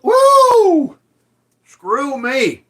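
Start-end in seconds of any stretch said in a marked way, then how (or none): 0.0-1.0 loud high-pitched celebratory whoop. Real, not acted. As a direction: let out a big excited woo.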